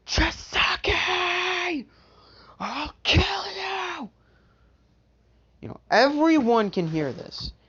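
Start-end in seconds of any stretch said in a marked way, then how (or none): 0.1-4.1 high, slow, crying war scream; furious yell. Acted. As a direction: scream it with tears in your throat. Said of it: "he yells, oh so loudly".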